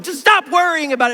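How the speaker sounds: impatient mock telling-off; exasperated command. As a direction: tell off a worrier, hammed up. Voices unhelpful command to a worrier.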